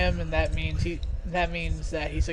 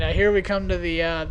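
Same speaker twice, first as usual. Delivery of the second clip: ordinary talk, nothing marked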